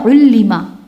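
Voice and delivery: "علمہ" said ullima, with u then i; past passive vowels. Shown as a demonstration. Teacher shows students the past passive, "علمہ" as ullima. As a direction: give "علمہ" the sounds u-i: ullima. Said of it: "او ای۔".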